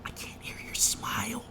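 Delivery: high, slow, breathy whisper mocking the boss; whispered mimic of boss. Mocking the manager's phone advice, in a high slow whisper. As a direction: hiss it slowly like a prissy supervisor. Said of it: "She's like".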